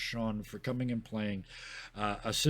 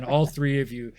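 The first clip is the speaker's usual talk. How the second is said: ordinary talk, nothing marked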